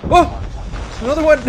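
high panicked shout; grenade warning yell. Real, not acted. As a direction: yell it out in alarm.

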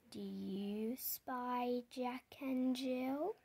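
ordinary delivery, nothing marked

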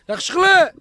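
loud high call across the field. Real, not acted. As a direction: call the horse from far away. Calling the horse from afar.

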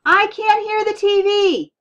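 very loud, high, stretched-out holler. A narrator's reading. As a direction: kid hollering a complaint over the racket. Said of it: "Ty hollered".

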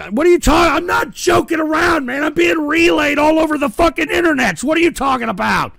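high, loud furious rant at the chat; angry shout. Real, not acted. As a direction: yell it, outraged at being doubted. Ranting at chat's doubt.

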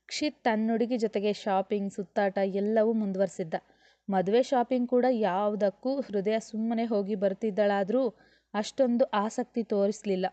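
ordinary delivery

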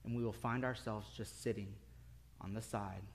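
hushed, low and grave; quiet, low sermon close with long pauses; solemn preaching. Real, not acted. Preacher turns solemn for the moral.

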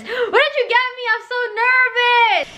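gasping, high and excited. Real, not acted. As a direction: squeal with nervous excitement. Nervous excitement before gifts.

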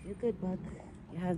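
soft whispered aside. Real, not acted. Owner whispering about the deer's food, soft whisper. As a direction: hush it under your breath.

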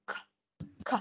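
the k sound alone, sharply released; isolated k sound. Shown as a demonstration. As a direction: pop a crisp k on its own. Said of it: "bật cái âm K ra". (bật âm k rõ ràng)

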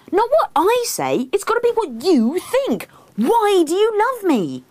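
very high, urgent demand; exasperated wife. A narrator's reading. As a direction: plead insistently in a high voice. Acts herself exasperated.

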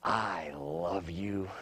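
soft, low, drawn-out murmur of love; hushed, melting, very slow delivery; little child's tender declaration. Retold or imitated. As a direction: imitate a child saying it slowly and tenderly. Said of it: "they look up at you and they go".

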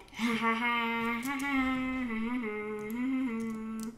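long, sustained mock cackle; slow, stretched-out fake laughter; drawn-out villain laugh. Villain laugh while hunting.

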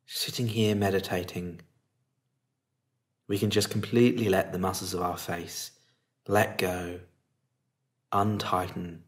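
calm, unhurried meditation lead with long pauses; guided meditation. Acted. Teacher settling listeners into meditation posture and body, calm guiding voice.

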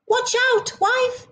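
high warning call; cried out. Acted. Husband up the tree calls a warning to his wife. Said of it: "he cried".

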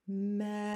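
Melon held out long and steady. Shown as a demonstration. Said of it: "It's a long one".

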